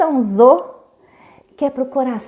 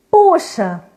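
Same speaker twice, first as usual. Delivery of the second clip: exclaiming; 6 semitones higher, 3 dB louder than usual. Acted. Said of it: "exclamava Olivia".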